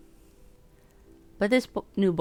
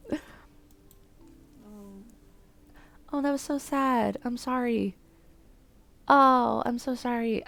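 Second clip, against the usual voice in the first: sympathetic; 6 semitones higher, much slower than usual. Real, not acted. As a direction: sound sorry and touched. Sympathy for crying boy.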